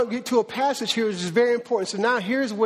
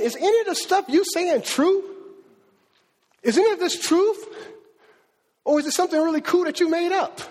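raised challenging question; 6 semitones higher than usual. Retold or imitated. Nobody asking the hard question.

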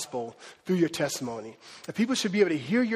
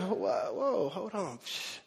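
much softer, as if quieting those around; hushed crowd; 9 dB quieter, much slower than usual. Acted. Crowd hushing each other to hear him, hushed. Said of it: "They get even more quiet".

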